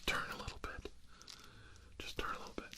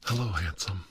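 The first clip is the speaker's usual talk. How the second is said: sudden voiced, lower and louder hello; a bold flirty hello, lower and louder than the whispers; flirty greeting; 7 semitones lower, 9 dB louder than usual. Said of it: "And I thought to myself".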